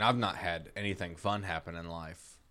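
ordinary talk

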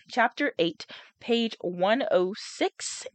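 ordinary delivery, nothing marked

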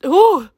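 steadying breath, near tears; high, loud "ooh" fighting tears; shaky high exhale. Real, not acted. Composing herself after near tears.

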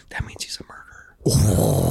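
slow, hushed gossip whisper; imitated whisper. Cellmates gossiping about the new inmate, whispering. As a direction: whisper it slowly, imitating nervous onlookers. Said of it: "like, whispering".

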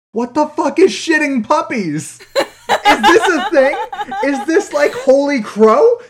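loud, high, baffled disbelief; incredulous outburst. Retold or imitated. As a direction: shout it out in disbelief. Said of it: "I'm like".

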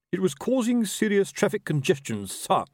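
high-pitched officer's report; stiff military report. Acted. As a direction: justify yourself stiffly to a superior. Officer justifies himself to ruler.

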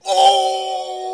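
a very high, loud gleeful oh; delighted shriek. Real, not acted. Streamer reacting to the clip, gleeful shriek. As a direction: squeal it out with glee.

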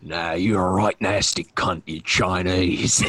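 menacing Australian butcher voice; Billy the Butcher impression. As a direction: drawl it like a menacing Cockney butcher. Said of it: "do your best, uh, Billy the Butcher impression".

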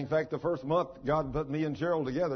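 usual speaking voice

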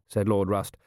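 plain reading voice

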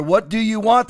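normal talking voice

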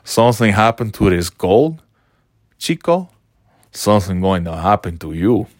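put-on understated tough-guy accent, taunting; light mock menace with a Cuban tinge; Razor Ramon impression. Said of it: "Razor Ramon".

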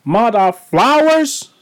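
high, stretched-out hype hello; hyped greeting. Real, not acted. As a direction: open the show like a big entrance. Podcast greeting, sung-out opener.

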